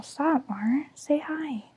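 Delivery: whispering to the cat; soft breathy whisper to a pet. Real, not acted. Talking softly to her cat.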